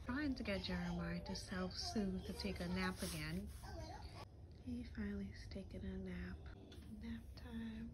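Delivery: hushed whisper to camera. Real, not acted. Child napping, mom whispers.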